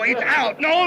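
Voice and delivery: ordinary talk, nothing marked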